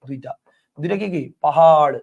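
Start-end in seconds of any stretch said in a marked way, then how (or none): 1.4-1.9 loud পাহাড় ending in a curled-tongue flapped ṛ; retroflex flap. Shown as a demonstration. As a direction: stretch পাহাড় and flip the tongue back on the final ড়. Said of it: "এই যে এখান থেকে আসবে পাহাড়".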